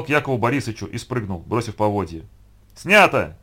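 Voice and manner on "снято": loud director's cut call; one loud call; shouting through megaphone. A narrator's reading. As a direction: shout it to the whole crew. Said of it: "закричал в рупор Яков Борисович".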